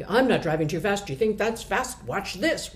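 a driver snapping back and speeding up; defiant outburst. Retold or imitated. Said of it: "a really dysfunctional thing".

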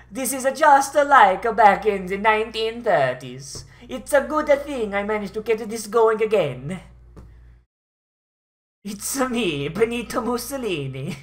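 hammy German dictator voice; German accent. Retold or imitated. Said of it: "I was doing a German accent".

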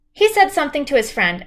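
ordinary delivery

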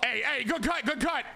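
high, peppy teammate shout-out; encouraging call. Pretend. Player encouraging his teammate, high peppy call. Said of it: "I gotta encourage my teammate".

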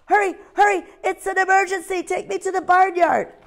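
a tiny owl pretending there's an emergency; little owl's alarm. Ozzie urging the pig.

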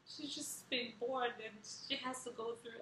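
high, mildly mock-weepy fretting; retold tearful pity. Emotional mother pitying her newborn in the scanner, tearfully tender. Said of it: "And then I cried".

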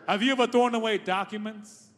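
ordinary talk

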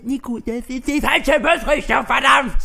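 furious shouted complaint; shouting. Acted. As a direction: bark at Nico over the wrong bus. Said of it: "Schreit er mich an".

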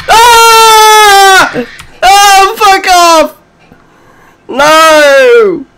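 high, loud panicked yelling; terrified shout. Real, not acted. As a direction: scream it away in real fright. Chased by monster.

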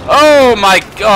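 high, loud, cut-off exclamation; startled outcry. Real, not acted. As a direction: yelp in shock and break off. Startled by enemies.

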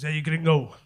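mock Mexican pimp accent; low, put-on Mexican accent. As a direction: call out in a hammy Mexican accent. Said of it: "Kind of the Mexican thing".

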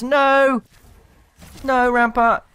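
loud high cry of no; groaning at the screen; dismayed shout. Real, not acted. Watching Rampart lose.

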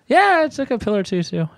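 high-pitched, breezy admission; mock carefree confession. Retold carefree confession to the officer.

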